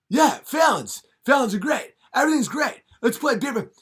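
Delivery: high, loud giddy talk-show voice; hammed-up high Fallon; giddy Jimmy Fallon. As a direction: gush like an overexcited Fallon. Fallon gushes.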